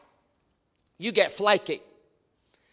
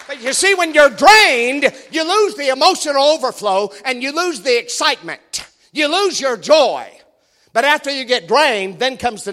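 preaching; 5 semitones higher, 7 dB louder than usual. Real, not acted. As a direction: preach it at full force. Preaching at full force.

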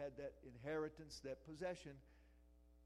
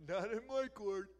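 high, loud taunting boast; playful imitation of a player's brag; basketball player impression; 7 semitones higher, 11 dB louder than usual. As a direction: swat it away with swagger. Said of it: "who's that guy, that basketball player".

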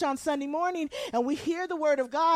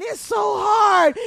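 loud, high, drawn-out mock wail; whining complainer; 8 semitones higher, 9 dB louder, slower than usual. Preacher mimics believers complaining to God, loud whiny wail. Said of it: "And you're saying".

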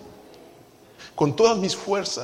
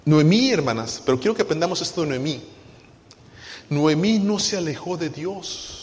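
ordinary talk, nothing marked

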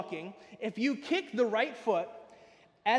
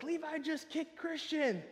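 slow, higher tattletale singsong; tattling kids; 7 semitones higher, 5 dB quieter, slower than usual. Retold or imitated. Children reporting Levi to the teacher, slow tattling singsong. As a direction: classmates telling on him to the teacher. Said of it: "everybody's like".

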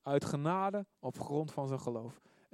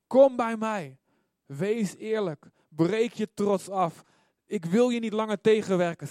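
loud, raised voice of warning and appeal; impassioned preaching; 5 semitones higher, 7 dB louder than usual. Acted. Preacher voicing God calling sinners to come, loud impassioned appeal. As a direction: cry out God's call with full force.

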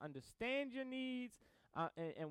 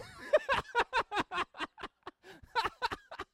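loud high laugh; real amused laughter; high burst of laughter; 14 semitones higher, 8 dB louder than usual. Real, not acted. Laughing at himself during sermon.

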